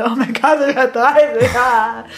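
loud, overdramatic wail of despair; dramatic panic. Retold or imitated. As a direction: ham up the horror of turning thirty. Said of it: "Und ich war so".

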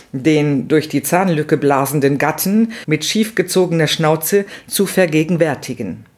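ordinary delivery

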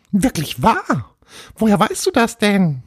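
high-pitched tiny ghost voice, wondering; amazed little ghost. Acted. Little ghost learns its name from Paul, high amazed voice. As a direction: marvel as a small ghost.

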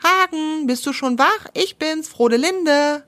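high calling voice up to the tree. Acted. (Wildschwein ruft hoch hinauf, höher)

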